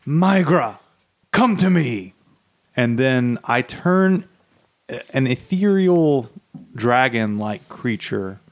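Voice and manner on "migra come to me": ritual summons. Acted. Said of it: "I go".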